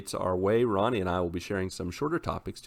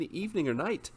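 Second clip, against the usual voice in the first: usual speaking voice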